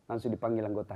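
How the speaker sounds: ordinary talk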